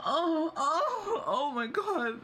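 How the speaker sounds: high, loud breathless oh my God; mock gasping excitement. To the chat, imitating a swooning high excited gasp. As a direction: squeal it out like a swooning fan. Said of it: "what about it would make you go like".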